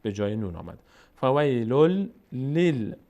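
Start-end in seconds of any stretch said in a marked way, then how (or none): 1.2-3.0 slow, louder فویلل لیل, leaning on each ل; drawn-out فویلل لیل, doubled l at the join; lam joined to lam. Shown as a demonstration. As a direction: recite فویلل لیل with the lams run together and held. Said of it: "دوباره لام میچسبه به لام".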